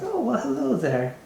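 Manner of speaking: a giddy noble host, all charm; giddy nobleman. Acted. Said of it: "he's got a very giddy attitude".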